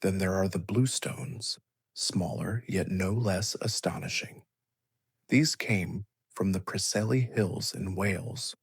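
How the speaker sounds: plain reading voice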